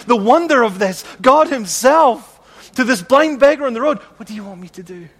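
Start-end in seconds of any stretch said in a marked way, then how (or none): 4.2-5.1 hushed question. Acted. Soft repeat of Jesus' question.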